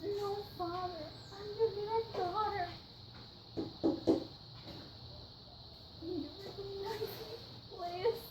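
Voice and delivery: soft, high tearful calling to a lost father; tearful plea. Acted. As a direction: reach out tenderly through tears. Daughter pleads with father.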